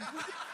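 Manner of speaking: a soft short chuckle; fond laugh. Real, not acted. Joel to the pastors about his mom: soft chuckle. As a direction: chuckle at the memory.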